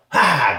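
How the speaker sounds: short loud undead roar; zombie growl. Acted. Actor records zombie sounds for the director, loud zombie roar. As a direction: bark it out like a lunging zombie.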